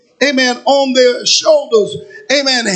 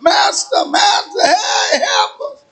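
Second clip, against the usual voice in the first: high, urgent mock holler; acted high-pitched plea; 7 semitones higher, slower than usual. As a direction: call out for help like the blind men. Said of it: "they was hollering out".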